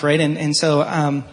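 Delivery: normal talking voice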